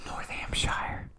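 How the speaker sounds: slow, very soft whisper; hushed, drawn-out North Hampshire. Shown as a demonstration. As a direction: whisper it slowly, testing the name. Murmurs the odd name to self.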